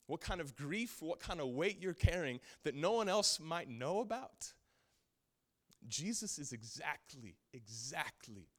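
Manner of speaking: loud, high impassioned preaching; preaching at full force. Acted. Preaching peak.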